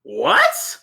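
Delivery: very high-pitched disbelieving yelp; astonished. Real, not acted. Player hearing the gnoll tells the truth, astonished high "what". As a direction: shoot the pitch right up in surprise. Player reacts to insight result.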